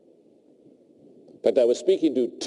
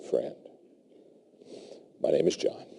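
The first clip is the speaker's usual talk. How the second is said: gentle aside; 6 semitones lower, 8 dB quieter than usual. Retold or imitated. Arm around the pushy CEO.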